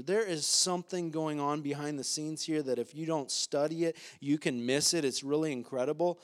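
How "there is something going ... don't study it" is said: normal talking voice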